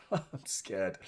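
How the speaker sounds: nervous laugh through the words; laughing nervously. Real, not acted. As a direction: laugh it off, still on edge.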